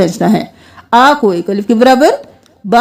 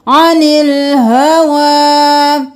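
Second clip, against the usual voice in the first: slow, chanted tajweed recitation; Quranic recitation; 2 semitones higher, much slower than usual. Shown as a demonstration. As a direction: chant it slowly, stretching the vowels. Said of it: "उनको खड़ी जबर के साथ पढ़ना है।".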